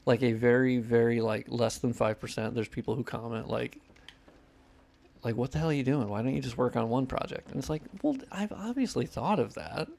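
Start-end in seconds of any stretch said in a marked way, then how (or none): none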